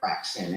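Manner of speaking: "Fraction" said low and falling, as a correction; correct pronunciation. Shown as a demonstration. Guest confirming her name to the host, low firm correction. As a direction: repeat "Fraction" firmly, pitch dropped, settling it. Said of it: "correcting us on how to pronounce your name".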